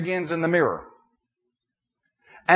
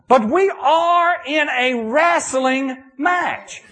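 preaching shout; 9 semitones higher, 5 dB louder, much slower than usual. Real, not acted. Preacher drives home point.

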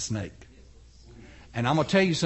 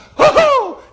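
a loud, high gasp of surprise; shocked gasp; 10 dB louder than usual. Acted. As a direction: gasp sharply at the hand. Hand changes again.

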